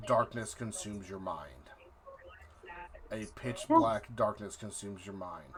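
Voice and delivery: ordinary talk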